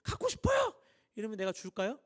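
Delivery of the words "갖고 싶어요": hammed-up tantrum, much higher, squealing up at the end; mock a little girl throwing a fit; whining child. Daughter throwing a fit for scissors, much higher whining squeal. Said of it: "막 떼쓰는 거".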